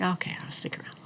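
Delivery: unvoiced, soft contented whisper; whispered aside. Accepting the raise, whispered aside. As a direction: breathe it out, satisfied.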